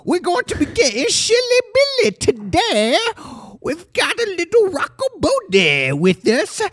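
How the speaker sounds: high, sing-song host voice; showman voice. Acted. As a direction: play the jolly show host. Host intro in put-on voice.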